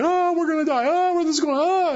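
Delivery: high, whiny mock panic; panicky disciples. Preacher mimicking the scared disciples, high mock panic. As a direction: squeal like scared disciples in the boat. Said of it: "They were always like".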